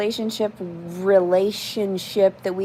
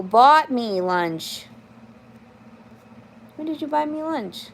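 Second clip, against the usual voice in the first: ordinary talk, nothing marked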